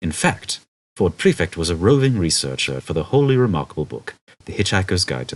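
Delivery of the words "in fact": ordinary delivery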